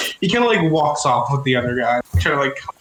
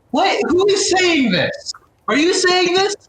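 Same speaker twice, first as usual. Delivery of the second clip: high, stretched-out incredulous questions; indignant bafflement; slower than usual. Acted. Scholar questions who insulted him, high and indignant. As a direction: pitch way up, demand the culprit. Scholar hears the hidden voice again.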